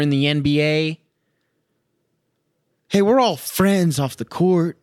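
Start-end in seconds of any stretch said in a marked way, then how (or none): 2.9-4.8 lightly voiced player, higher and chummy; a hint of a jolly pro player; mock buddy voice. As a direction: imitate a grinning athlete, a touch mocking. Mocking players' friendliness.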